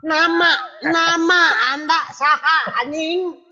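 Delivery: high, drawn-out exasperated yell; angry shouting. Pretend. Host demands the deaf-seeming guest's name, shouting angrily. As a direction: bellow the question slowly, losing all patience. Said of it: "Kenapa marah-marah sih?".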